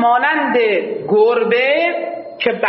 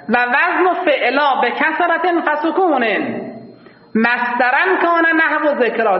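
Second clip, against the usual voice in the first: recited verse; 4 semitones higher than usual. Shown as a demonstration. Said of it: "reads verse text".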